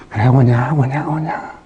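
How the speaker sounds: put-on calm a crying child gently; soothing father. God soothing a hurting believer, low and tender.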